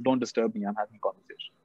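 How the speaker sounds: low, soft dismissal; calm king who won't be disturbed; unperturbed king. Retold or imitated. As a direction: wave off the guard quietly, pitch dropped. Calm king dismisses the alarmed guard.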